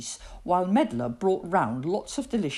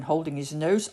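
ordinary delivery, nothing marked

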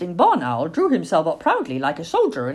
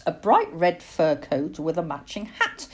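usual speaking voice

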